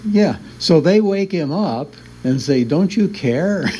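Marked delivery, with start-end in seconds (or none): 2.6-3.6 higher-pitched, drawn-out pleading; mock reproach. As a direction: plead like a neglected follower, half joking. Retold disciples waking sleeping Jesus.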